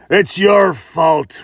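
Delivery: huge brute. Acted. Huge man blames the other.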